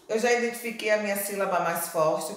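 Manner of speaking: normal talking voice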